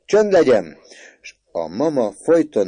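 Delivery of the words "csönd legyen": very high, loud order; commanding shout. A narrator's reading. Mother silencing her son amid laughter, commanding shout. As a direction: order silence over the protests.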